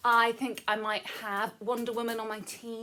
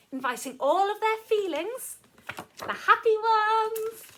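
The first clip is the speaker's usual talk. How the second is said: happy excitement; 10 semitones higher, 9 dB louder than usual. Acted. Listing feelings at story's party.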